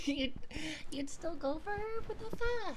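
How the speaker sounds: high, loud squeal of disbelief; shocked outrage. Pretend. Streamer learns chat would still choose mom, shocked squeal. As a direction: shriek it upward in the cute voice. Mock outrage at chat's answer.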